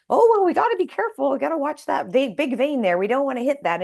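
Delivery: high, fast mock-chirpy doctor voice; imitating the endocrinologist. Mocking the endocrinologist before the biopsy, high quick chirp. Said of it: "she's talking like this".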